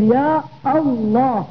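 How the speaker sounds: slow "يا الله" with a clear glottal stop starting "الله"; cut hamza. Shown as a demonstration. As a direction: stretch "يا", break, then sound the hamza of "الله". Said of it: "تجعل الهمزة التي في لفظ الجلالة همزة قطع".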